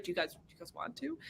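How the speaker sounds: quiet question. Retold or imitated. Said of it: "He's like".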